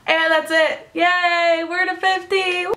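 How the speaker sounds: high, bright celebration; excited cheer. Real, not acted. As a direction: cheer for reaching fifty. Celebrating fifty subscribers.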